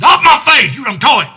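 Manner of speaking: high, loud banishing roar; king screaming at his daughter; enraged shout. Acted. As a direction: throw her out with fury.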